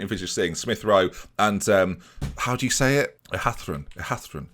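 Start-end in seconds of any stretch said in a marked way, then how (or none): 3.3-4.5 Ehathron with a lisping th, soft and low. Shown as a demonstration. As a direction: say Ehathron with a lisp, th for s. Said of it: "I'm doing it with a bit of a lisp".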